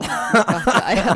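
drawn-out laughing word. Real, not acted. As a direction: laugh it out slowly. Laughing at own absurd claim.

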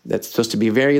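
ordinary talk, nothing marked

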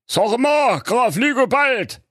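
high, slow fussy king voice; a puzzled old king raising a complaint. A narrator's reading. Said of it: "sagte der König".

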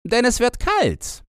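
put-on high, brash young robber girl; lively high-pitched girl voice. A narrator's reading. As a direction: play the bold little robber girl, high.

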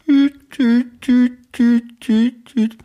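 high beeping busy tone; mouth busy signal. Pretend. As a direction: beep like a hung-up phone. Imitating a hang-up tone.

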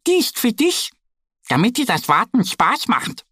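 high duck voice; little duck giving a gift. Acted. Duck Annie gives her friend a tin, high and kind. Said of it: "sagt sie".

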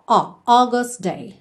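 "August" begun with an open o sound. Shown as a demonstration. Teacher models the o sound in August. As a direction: say "O, August day" with o, not a-u. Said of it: "o som é O".

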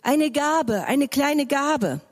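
high, slow begging call; beggar calling. Acted. Beggar calls to the shepherd boy, high pleading. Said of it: "Ein Bettler rief zu ihm".

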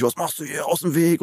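mock unfriendly telling-off. Cyclists to a newcomer walking on the bike path, mock unfriendly. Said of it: "alle nur geklingelt und unfreundlich".

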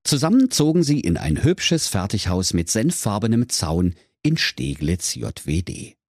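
plain reading voice

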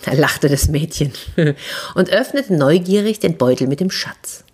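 plain reading voice

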